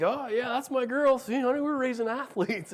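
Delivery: high, bright-eyed bragging; a proud father showing off to his wife, excited; proud excited dad. Retold or imitated. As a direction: crow it like a thrilled dad. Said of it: "And so I was like".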